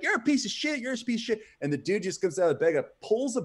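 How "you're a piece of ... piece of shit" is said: high, shrill-pitched mock yell at the man; yelling girlfriend. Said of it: "she's yelling".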